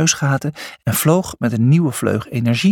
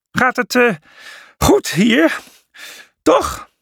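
out of breath, high, broken phrases; Cornelius; 11 semitones higher, slower than usual. A narrator's reading. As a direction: puff the question out between breaths. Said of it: "pufte Cornelius buiten adem". (buiten adem puffend, hortend)

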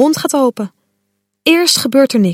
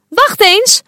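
calling out; 9 semitones higher than usual. Acted. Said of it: "roept Gropje".

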